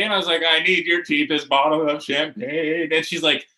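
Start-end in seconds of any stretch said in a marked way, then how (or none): 0.5-2.9 mildly tearful retold request; a touch of mock crying in the voice; imitated tearfulness. Crying player to liquor store lady, mildly tearful. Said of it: "I'm still crying".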